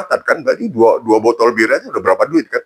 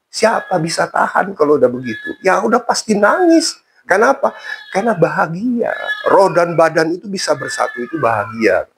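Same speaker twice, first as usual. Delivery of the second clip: joyful explanation; 8 semitones higher than usual. Retold or imitated. Habib explains tears with joy.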